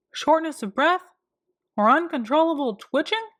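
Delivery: eager doctor reeling off a checklist; fussy doctor. A narrator's reading. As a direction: list each symptom like a busy doctor.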